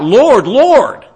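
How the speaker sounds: high, loud imploring cry. Acted. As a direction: call out desperately for mercy. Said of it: "they're gonna cry out".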